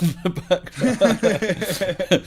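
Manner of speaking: chuckling through the words; half laughing, slowed down. Real, not acted. Laughs while explaining.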